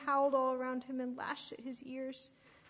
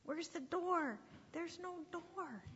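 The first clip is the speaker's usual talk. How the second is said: higher-pitched questioning sheep voice; lightly voiced doubtful lamb; puzzled little sheep; 7 semitones higher, 3 dB quieter, slower than usual. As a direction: ask it high and puzzled.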